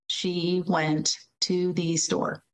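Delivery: "She went to the store" slow, each word separate, "to" said fully. Shown as a demonstration. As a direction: chop it into single words, slowly. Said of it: "you might say".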